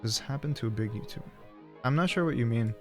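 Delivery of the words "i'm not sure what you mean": ordinary talk, nothing marked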